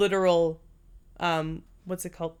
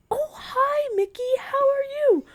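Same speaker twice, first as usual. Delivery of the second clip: very high squeaky cartoon greeting; imitated old aunt doing cartoon chat; Auntie Gloria; 3 dB louder than usual. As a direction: greet a doll in a piercingly high mouse voice. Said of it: "doing the Mickey Mouse voice".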